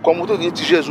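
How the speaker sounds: ordinary talk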